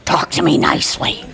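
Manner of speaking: hoarse, voiceless parrot rasp; bird's demand. Retold or imitated. Lady staking her claim as a creature, raspy demand. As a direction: croak it low and rough. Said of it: "Lady staked a claim that day".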